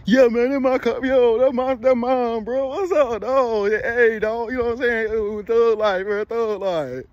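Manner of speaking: mock thug bravado. Mocking the flashy guy he's not, hyped-up thug voice. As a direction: show off like a wannabe thug. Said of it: "It's because I wasn't all".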